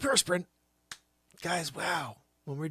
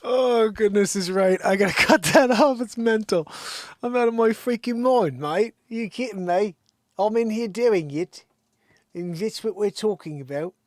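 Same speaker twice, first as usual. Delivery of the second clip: loud, high mock-British ranting; loud cockney-ish mania; manic British bloke; 7 semitones higher, 11 dB louder than usual. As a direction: go full cheeky Brit. Manic put-on voice after laughing.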